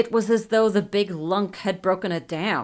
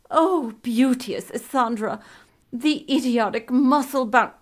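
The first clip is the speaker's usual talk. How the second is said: raised, florid, courtly declamation; lightly pompous gallant addressing his lady; Elutriel; 5 semitones higher than usual. A narrator's reading. Elutriel's florid speech via king.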